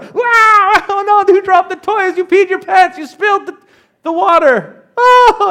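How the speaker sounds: high, loud, slowed wail of alarm; over-the-top shriek of fake distress; mock panicked adult. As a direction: ham up a grown-up losing it over spilt water. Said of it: "adults don't start showing up and start".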